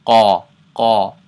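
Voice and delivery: Qa from the back of the throat, loud; heavy Arabic Qaf in Qa; deep back-of-mouth Qa. Shown as a demonstration. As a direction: push Qa far back in the mouth. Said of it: "it should be in the back of the mouth".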